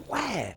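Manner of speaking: short, high burst of a fighting yell; mock attack yell. Movie character striking the clerk, sudden mock yell. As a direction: yell as he throws the punch. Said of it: "And he goes".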